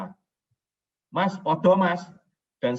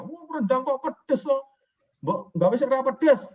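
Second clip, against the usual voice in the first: complaining Jogja customer; 11 semitones higher, 4 dB louder than usual. Retold or imitated. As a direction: whine it like a fussy buyer from Jogja. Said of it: "dikomplain sama orang Jogja".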